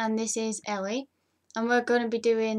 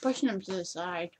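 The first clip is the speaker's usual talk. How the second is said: cheeks stuffed full; 4 semitones lower, 3 dB quieter than usual. Real, not acted. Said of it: "you can see the marshmallows in my mouth".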